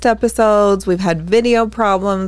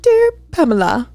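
butler voice; 6 semitones higher than usual. Pretend. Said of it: "Do I have to do the accent?".

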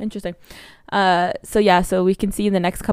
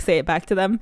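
ordinary delivery

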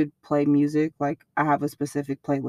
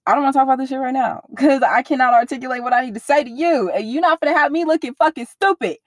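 high, fast, worked-up venting; rapid heated rant; 7 semitones higher, 4 dB louder than usual. Retold or imitated. To her boyfriend after a fight, refusing to talk yet, fast heated rant. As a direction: rattle it off like you're fed up.

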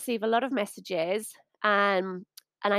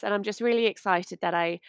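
ordinary talk

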